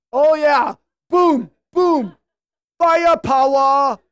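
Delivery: loud mock-macho shout; hammed-up bar-fight bellow; fight taunt. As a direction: punch out each word, loud and drawn out.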